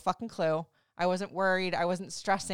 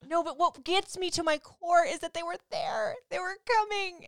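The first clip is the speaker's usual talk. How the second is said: much higher, heated playful protest; exasperated protest; 11 semitones higher than usual. Real, not acted. Wife teasing husband on the podcast, high and exasperated. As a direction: squeal how close the tears came.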